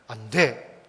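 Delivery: low, decisive command without yelling; firm, stern no. Shown as a demonstration. Pastor shows parents how to refuse a child, firm and low. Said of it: "소리치지 않고 화내지 않고 엄하게 저음의 목소리로 단호하게".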